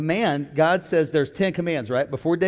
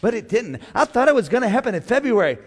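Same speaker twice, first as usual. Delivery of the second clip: mock pleading single woman; 6 semitones higher than usual. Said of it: "Somebody's praying".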